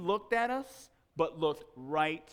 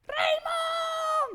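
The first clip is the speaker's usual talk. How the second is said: high, loud, drawn-out holler; screaming mother's voice, Spanish pronunciation; mom's very loud call; 5 dB louder than usual. Retold or imitated. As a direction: holler for a child like a mom calling across the woods. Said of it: "His mom's very loud voice".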